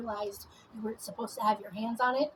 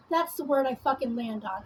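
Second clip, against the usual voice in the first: ordinary talk, nothing marked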